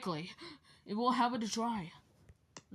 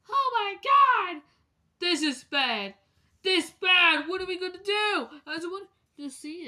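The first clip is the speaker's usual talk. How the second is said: panicked; 7 semitones higher, 5 dB louder than usual. Pretend. Characters panic over bad news.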